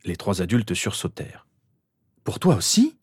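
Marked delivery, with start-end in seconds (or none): 2.2-3.0 high, startled question; shocked exclamation. A narrator's reading. Mother startled by her son's prophecy, high exclamation. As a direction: blurt it out, pitched way up. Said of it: "s'exclama Lily".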